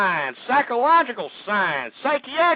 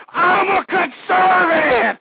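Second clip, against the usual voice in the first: "I'm a conservative" screamed high, loud and slow; screaming again; 11 semitones higher, 9 dB louder, slower than usual. Real, not acted. Radio host repeats his creed to listeners, slow full shout. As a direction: hold the scream out to the last syllable. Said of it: "I don't mean to be screaming out my head".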